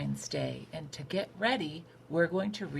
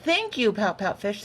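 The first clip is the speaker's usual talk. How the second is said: grateful squid; 5 semitones higher, 6 dB louder than usual. A narrator's reading. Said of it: "said Missus Squid".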